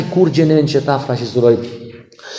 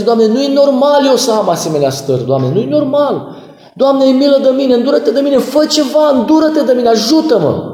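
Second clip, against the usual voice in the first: pleading prayer; 8 semitones higher than usual. Retold or imitated. Pastor retells his prayer.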